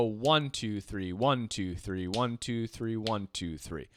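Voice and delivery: soft low triplet count fading out. Shown as a demonstration. Teacher counts triplets softly along with the metronome. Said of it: "So this is going to be triplets".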